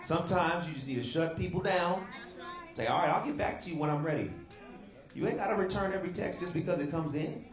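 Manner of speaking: high, loud, driving preacher call; rally the congregation to take control; preaching at full force. Real, not acted.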